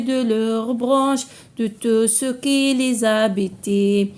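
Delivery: slow, measured poem reading with sustained, level notes; poem recitation. Shown as a demonstration. Teacher reads the poem to pupils, slow measured recitation. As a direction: recite line by line, drawing out the words.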